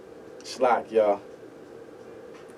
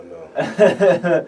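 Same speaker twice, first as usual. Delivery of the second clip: laughing; 7 semitones higher, 9 dB louder than usual. Real, not acted. Laughs after losing his flow.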